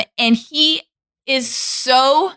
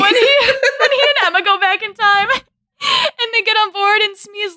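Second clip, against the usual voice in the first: laughing; 10 semitones higher than usual. Real, not acted. Laughing while retelling.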